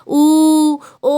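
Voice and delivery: loud, long, level ऊ, then a held ओ; rounded vowels. Shown as a demonstration. Teacher showing the round mouth shape, sustained ऊ and ओ. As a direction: push out ऊ and ओ through circled lips. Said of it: "एकदम ऊ बोलोगे तो व्रत जैसा".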